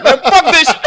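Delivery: high, loud mock-angry burst; angry outburst. Guest acting out their angry teenage self, mock angry outburst. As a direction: blurt it out high, as if fed up. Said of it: "when I was angry now".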